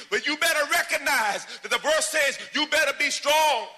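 high, loud sermon hammering home; preaching at full force. Acted. Pastor exhorting the church to be strong, loud and high. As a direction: drive the verse home with fire.

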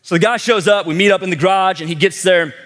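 usual speaking voice

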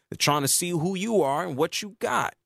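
ordinary delivery

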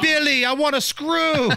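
high-pitched movie-wife imitation; hammed-up high whine of a demanding wife; Rosie Perez. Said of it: "She's Rosie Perez right there".

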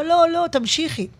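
high-pitched mock plea to continue; acted insistent high-voiced protest; imitated audience plea. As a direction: imitate a crowd urging her on, voice lifted high. Acts audience begging for more.

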